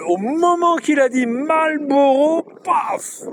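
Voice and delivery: drawn-out high suspense, broken by a sudden paf; dramatic build-up. Retold or imitated. Telling viewers how Adil was caught at the till, dramatic build-up. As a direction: stretch it out, rising high, then an abrupt paf. Dramatic retelling of police swooping in.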